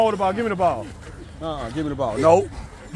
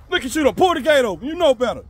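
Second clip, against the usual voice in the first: raised, higher-pitched scolding at the dog; 7 semitones higher, 5 dB louder than usual. Real, not acted. Dad scolding the dog for pulling the gate open, loud and high. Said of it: "I'm not yelling".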